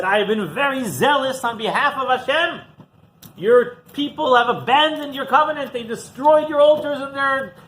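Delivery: he repeats his complaint without softening at all; same zealous tone. Acted. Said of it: "didn't change his tone one bit".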